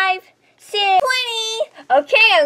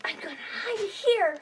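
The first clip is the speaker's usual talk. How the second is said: whisper; 13 dB quieter than usual. Real, not acted. Hiding during hide and seek.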